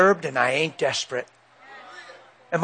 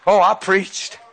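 ordinary delivery

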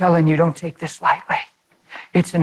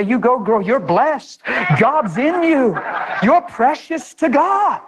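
rising, slow, fervent; affirming preaching; 5 semitones higher, slower than usual. Retold or imitated. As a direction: build it up to the mirror. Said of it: "look in the mirror and say".

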